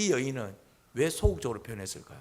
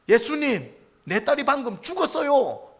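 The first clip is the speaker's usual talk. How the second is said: slow, raised-voice desperate appeal; loud plea aloud; 8 semitones higher, 6 dB louder, slower than usual. Acted. Synagogue leader kneeling before Jesus over his daughter, loud plea. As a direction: cry out to Jesus, high and loud. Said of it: "크게 했다 이 말이죠".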